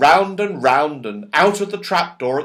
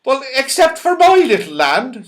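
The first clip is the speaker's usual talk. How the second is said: mournful; 11 semitones higher, 7 dB louder than usual. Acted. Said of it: "rather mournfully".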